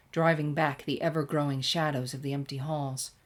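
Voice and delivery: ordinary delivery, nothing marked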